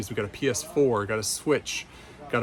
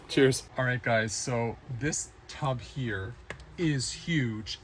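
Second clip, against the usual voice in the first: ordinary talk, nothing marked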